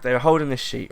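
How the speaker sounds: ordinary talk, nothing marked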